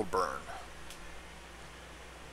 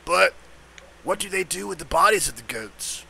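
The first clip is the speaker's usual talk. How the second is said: werewolf; 7 semitones higher than usual. Acted. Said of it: "I got the werewolf's voice down pat".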